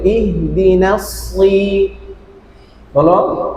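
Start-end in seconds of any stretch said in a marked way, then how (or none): 0.0-1.9 ইহদিনা সি- drawn out very slowly with a heavy, full S; heavy Quranic sound. Shown as a demonstration. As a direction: model ইহদিনা সি- slowly for the student to copy. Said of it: "আরেকটু মোটা করে".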